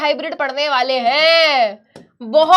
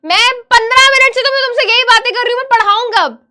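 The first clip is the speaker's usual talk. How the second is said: raised pitch, quick exasperated complaint; exasperated protest; 5 semitones higher, 3 dB louder than usual. Real, not acted. Teacher fed up with students delaying the lesson, exasperated. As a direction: rattle it out, fed up and pleading.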